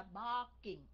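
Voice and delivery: BARKING with a gentle B, drawn out; âm B nhẹ nhàng. Shown as a demonstration. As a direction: slowly model the B in BARKING. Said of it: "nhẹ nhàng theo chữ B đó". (đọc chậm "barking" với âm B nhẹ)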